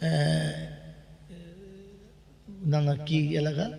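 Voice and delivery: normal talking voice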